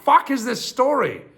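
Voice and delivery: high-pitched, baffled protest; incredulous outburst. Real, not acted. Reader stunned by the princesses' threat, incredulous outburst. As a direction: burst out of the reading in disbelief.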